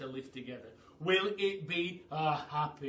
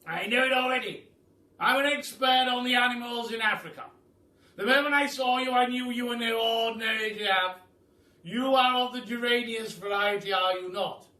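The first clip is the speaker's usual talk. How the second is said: loud, grand aristocratic Duke, higher; booming Duke; 9 semitones higher, 13 dB louder than usual. Acted. The Duke welcomes the hungry giraffe, booming and grand. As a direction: boom it out like a jolly grand duke.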